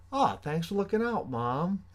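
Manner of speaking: high sarcastic thanks. Retold or imitated. Answering mom's remark, high sarcastic thanks. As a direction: sneer a high mock thank-you.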